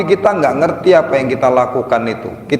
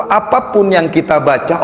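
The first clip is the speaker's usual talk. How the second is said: ordinary talk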